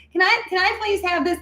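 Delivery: put-on very high, squeaky toddler pleading; whiny little girl. Three-year-old pleading with her dad for something, squeaky and high. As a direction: go way up into a tiny girl's begging voice. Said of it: "in my head, that's how I sounded".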